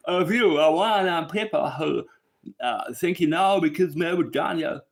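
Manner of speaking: put-on loud, high-pitched botched Dali Vision accent; badly faked Dali Vision accent. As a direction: exaggerate Dali Vision's accent and get it wrong. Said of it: "I cannot do the accent".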